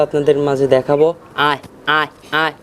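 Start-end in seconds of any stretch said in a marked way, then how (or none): 1.5-2.5 calling pigeons. Real, not acted. Farmer calls his pigeons in to feed, a gentle coaxing call. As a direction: coax the pigeons over with a sing-song call.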